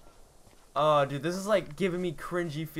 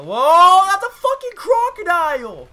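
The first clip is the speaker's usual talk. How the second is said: 1 panicked yelling; 13 semitones higher, 13 dB louder than usual. Real, not acted. Crocodile appears, hits knee.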